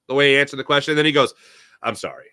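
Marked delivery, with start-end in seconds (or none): 1.8-2.3 low, soft mumble of an apology; retold apology. Quarterback apologizing to reporters for being short, low and soft. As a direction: imitate a quiet, contrite sorry. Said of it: "He apologized right there".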